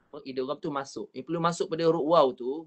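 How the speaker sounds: ordinary talk, nothing marked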